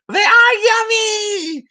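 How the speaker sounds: high, slow, drawn-out cry of delight; excited squeal. Real, not acted. Vlogger raving to viewers about food, high excited cry. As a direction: sing out how yummy it is.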